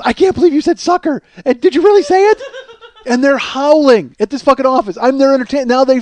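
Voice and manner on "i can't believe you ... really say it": high, amused squeal of disbelief; incredulous wife. Retold or imitated. Wife to husband as the office cracks up, incredulous. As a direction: imitate a wife cracking up, pitched way up.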